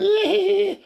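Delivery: shrill cackling laugh imitation; high mock laughter. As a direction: cackle like the audience did.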